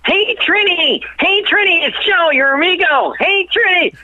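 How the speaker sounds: cheery high-pitched greeting shouted over the phone; calling out to make him laugh. Retold or imitated. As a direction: sing out his name to get a laugh from him. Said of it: "this thing that I always make him laugh".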